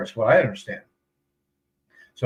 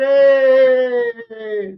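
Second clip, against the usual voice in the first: very high, loud cheerful hey; excited call; 6 dB louder than usual. Retold or imitated. Locals greet the expat with a very high, loud hey. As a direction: call out happily to a friend.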